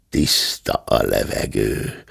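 low, slow growl under the breath. A narrator's reading. Hörpentő climbing out of the chest, growling.

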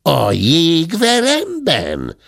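high, slow, puzzled mishearing; feigned deafness. A narrator's reading. Hörpentő faking deafness to the maid, slow and high. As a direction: play hard of hearing, drawn out. Pretend-deaf guest mishears.

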